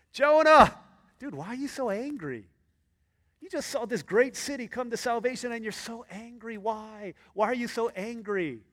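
lightly voiced God pressing Jonah; God questioning Jonah. God pressing angry Jonah, lightly voiced exasperated questioning.

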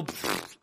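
short scornful puff through the lips; dismissive pfft. Acted. Believer facing down the devil, dismissive lip puff. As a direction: blow a raspberry-like puff of defiance. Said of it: "look the devil in the face and go".

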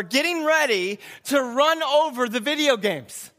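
ordinary talk

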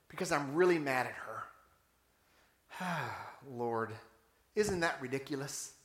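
low, soft admission with a sigh; rueful confession. Acted. Pastor admitting to the Lord he is mad at his neighbor, rueful confession. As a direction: sink into a hushed, sheepish prayer.

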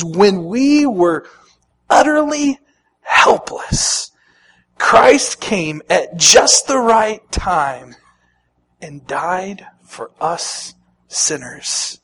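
very slow, lingering verse reading; heartfelt scripture reading. Acted. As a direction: stretch each phrase of the verse slowly. Reads favorite verse.

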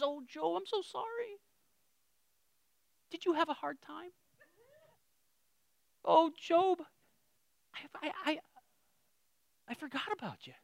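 a God caught out, sorry and sweet; mock-sheepish God. God apologizing to Job for forgetting him. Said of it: "And God says".

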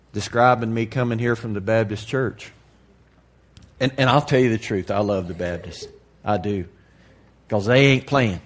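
usual speaking voice

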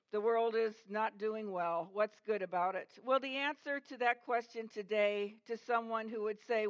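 normal talking voice

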